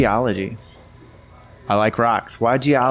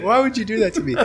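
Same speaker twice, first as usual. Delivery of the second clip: mock whiny girlfriend; 12 semitones higher, faster than usual. Mocking the girlfriend's voice, laughing.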